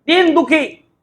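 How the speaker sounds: दिन drawn out slowly; stress on दिन. Shown as a demonstration. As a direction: lean on the long vowel in दिन, as in दीन. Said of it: "जिस पर जोर पड़े".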